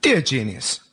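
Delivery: normal talking voice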